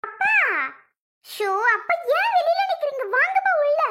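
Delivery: high, pleading call to come inside; eager, high-pitched coaxing; urging. Acted. Daughter calling her father in from outside, urging.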